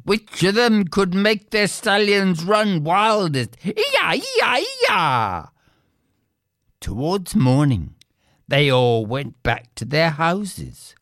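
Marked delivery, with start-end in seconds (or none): 3.6-5.4 high "ee-yah" whooping cry; driving cry. Acted. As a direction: urge the horses on. Girls urging stallions on.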